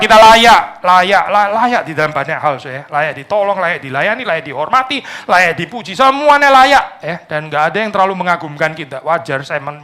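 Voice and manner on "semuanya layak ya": high, loud, slow drawn-out claim; mock entitlement. Preacher mocking entitled people, slow and high. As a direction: sneer it like someone who thinks they deserve everything.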